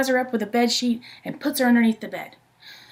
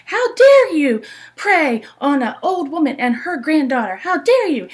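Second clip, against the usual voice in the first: much louder, higher, indignant scolding; furious grandma; 5 semitones higher, 7 dB louder than usual. Acted. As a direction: tell the wolf off as you swing the bat. Grandma beating the wolf.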